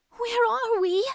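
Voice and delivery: put-on scared little girl asking, high and small; frightened question from a lost child; whimpering child. A narrator's reading. Girl clings to her brother, frightened and high-voiced. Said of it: "she whimpered".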